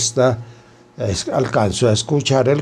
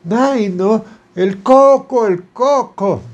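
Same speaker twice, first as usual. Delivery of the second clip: high squeaky mock of a terrified woman; shrill parody of a scared wife crying about the coco; mocking frightened wife; 9 semitones higher, slower than usual. Doctor mocks the frightened wife.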